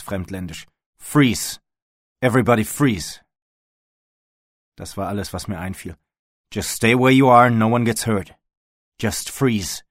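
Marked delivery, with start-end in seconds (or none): 1.0-3.2 loud, high shouted order; forceful, raised-pitch standoff shout; bellowed command. A narrator's reading. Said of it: "brüllte ich ihm zu". 6.5-9.8 loud, forceful order at gunpoint; raised, commanding standoff voice; shouted command. A narrator's reading. Armed man shouting orders at group.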